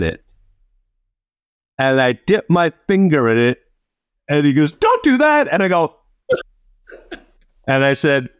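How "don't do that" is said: high, urgent "stop that"; alarmed authority halting a student; sharp warning. Retold or imitated. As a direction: bark a quick warning to stop. Principal stopping him mid-dip.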